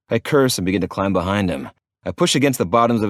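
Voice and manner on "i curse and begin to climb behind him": usual speaking voice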